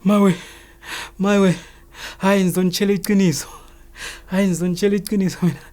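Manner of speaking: high, loud, frightened begging; imitated scared pleading. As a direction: wail like a terrified suspect giving in. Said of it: "he was so scared".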